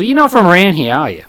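imitated curious Australian customer; Australian accent. Customer questions where the worker is from, Australian accent. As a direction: probe casually in an Australian accent. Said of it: "Remember the Australian guy".

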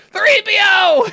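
very high, loud mock yell of the name. Echoing a friend's joke about Luke, high mock yell. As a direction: shriek it like old Luke calling his droid.

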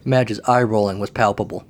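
ordinary delivery, nothing marked

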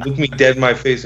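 normal talking voice

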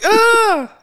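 sudden high shrieking yelp; startled scream. Retold or imitated. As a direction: jump out of your skin with a shriek. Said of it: "I was like".

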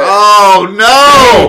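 loud, high "oh no!"; shocked. Real, not acted. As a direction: cry out in mock horror. Mock shocked outburst.

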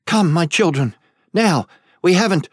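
put-on queen calling her children to flee, urgently. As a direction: hurry the children out before it's too late.

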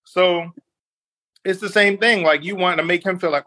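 normal talking voice

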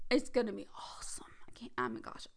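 hushed, can barely contain it; giddy whisper. Real, not acted. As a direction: whisper like you're too excited to speak. Overwhelmed by anticipation.

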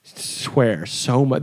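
ordinary talk, nothing marked

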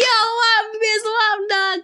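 very high, hyped-up mock hail; playful greeting. Acted playful greeting back to bartender.

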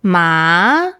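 "Ma" with a long upward slide; second tone on Ma. Shown as a demonstration. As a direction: lift "Ma" like a question. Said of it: "that's hemp or numb".

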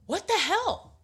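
high, loud shocked yelp; sudden high shocked cry; startled outburst. Retold or imitated. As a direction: blurt it out in shock. Mom's startled outburst retold.

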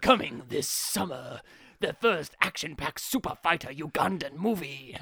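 grand cinema trailer narration. Retold or imitated. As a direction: announce it like a blockbuster trailer. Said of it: "I went for the, the cinema ones".

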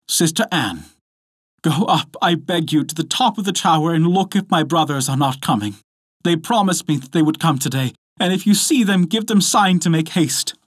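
high, hurried woman's plea; urgent wife. A narrator's reading. Wife begging her sister to look out, urgent.

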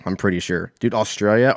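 ordinary talk, nothing marked

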